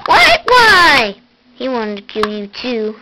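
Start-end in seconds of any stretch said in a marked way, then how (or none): 0.1-1.1 high, loud outraged what; indignant shock. Pretend. Toy protesting being blocked from the room, high and loud. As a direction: protest in pretend disbelief.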